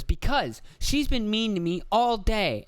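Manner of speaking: high, slow kid voice, mimicking his articulation; imitating toddler son. Son explaining why he won't apologize, high toddler voice. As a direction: whine the excuse like a three-year-old. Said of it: "his articulation ain't far off from this".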